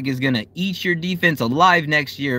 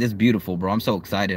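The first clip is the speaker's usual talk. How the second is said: ordinary talk, nothing marked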